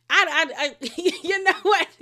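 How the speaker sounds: high, loud words breaking into a laugh. Real, not acted. Laughing at her own rant.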